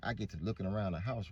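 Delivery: ordinary talk, nothing marked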